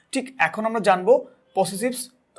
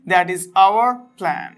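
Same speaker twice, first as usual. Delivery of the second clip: slow example read; much slower than usual. Shown as a demonstration. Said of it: "আরেকটি বাক্য দেখতে পাচ্ছি".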